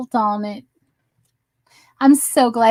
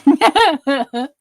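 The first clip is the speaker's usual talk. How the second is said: real laugh; 4 semitones higher than usual. Real, not acted. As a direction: burst of high, bright laughter. Reacting to viewer's joke.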